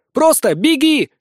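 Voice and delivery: high, loud cry to run; urgent exclamation. A narrator's reading. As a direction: shout it to get them moving. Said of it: "воскликнула миниатюрная блондинка". (громко, срочно, высоким голосом)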